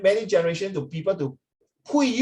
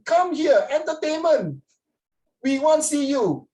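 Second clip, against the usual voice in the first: high, slow mock incredulity; 9 semitones higher, slower than usual. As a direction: ridicule the silly telegram, much higher. Mocking the garbled telegram.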